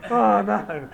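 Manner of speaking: loud, high dismayed cry; a sudden loud groan of dismay. Retold or imitated. Guy realising car will scratch.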